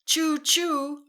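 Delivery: high, loud choo choo; train whistle. Shown as a demonstration. Teacher making train sound for kids, loud. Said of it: "your turn to make the sound".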